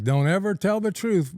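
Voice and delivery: high-pitched acted admonishment; animated mock caution; imitated emphatic warning.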